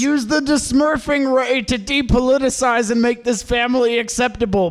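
normal talking voice

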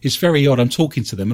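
ordinary delivery

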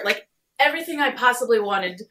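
getting emotional. Real, not acted. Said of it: "you're getting a little emotional".

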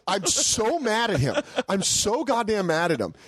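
ordinary talk

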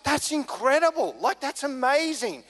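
high-pitched retold amazement, slowing at the end; blown away. Mate amazed at being covered for, blown away. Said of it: "He was blown away".

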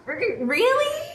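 put-on high-pitched shy girl; nervous high voice. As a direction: ask shyly, voice climbing high. Nervous girl asks for date.

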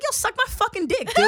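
high-pitched retold angry comeback; defiant retort. Retold insult to a heckling kid.

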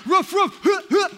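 high, loud yappy barks; barking dog. Retold or imitated. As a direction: yap like a tiny frantic terrier. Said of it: "here's what it sounds like".

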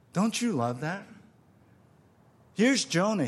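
ordinary delivery

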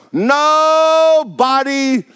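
long drawn-out nobody. Acted. Drawn-out exclaim.

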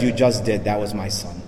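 quiet, low retold reply after the outburst; hushed revelation. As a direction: answer gravely as the forgiving father, voice sinking. Deacon's reply to his son's killer.

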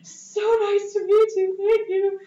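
high, loud, drawn-out starstruck squeal; flustered fangirl gushing. Retold or imitated. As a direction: gush at the artist, hammed-up and overwhelmed. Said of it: "Bark noises".

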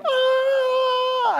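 very high, drawn-out hammed-up wail; mock wailing cry. Mary Magdalene crying at the empty tomb, hammed-up wail. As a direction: imitate a woman bawling in a high squeal. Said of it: "and she's crying".

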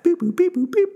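high robotic beep boop computing noises; toy robot voice. Pretend. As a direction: beep like a little robot doing math. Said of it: "Hold on, math".

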